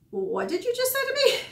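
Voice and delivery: high, incredulous outburst; outraged disbelief. Retold or imitated. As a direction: pitch it way up in shocked disbelief. Stunned reaction retold.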